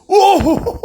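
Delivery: sudden high shriek of joy; excited scream. Real, not acted. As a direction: shriek with delight at the pull. Pulls a foil card, screams. (cri de joie aigu)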